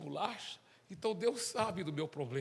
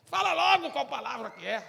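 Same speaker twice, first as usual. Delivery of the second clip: impatient demand; 8 semitones higher, 6 dB louder than usual. Acted. President demands the word.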